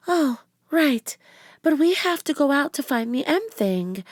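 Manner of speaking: put-on high young girl voice; a light little girl. A narrator's reading. Narrator voices Annie.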